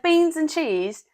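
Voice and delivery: high, slow British vendor call; put-on British accent. Food-cart seller calling the order, put-on British accent. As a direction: draw out a hammy British accent. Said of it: "he's like".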